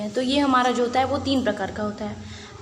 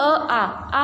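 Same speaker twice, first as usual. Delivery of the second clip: drawn-out vowel. Shown as a demonstration. Teacher holding आ long to show a pluta vowel. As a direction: hold the आ long and slow. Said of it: "लंबे समय के लिए इसका उच्चारण करेंगे".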